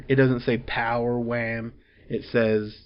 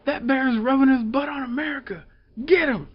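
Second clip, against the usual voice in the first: much higher, hammed-up patriotic shout; outraged soldier yell; 13 semitones higher than usual. Soldier orders the attack on the bear, hammed-up high yell. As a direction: bark the order like an indignant soldier. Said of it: "a soldier is like".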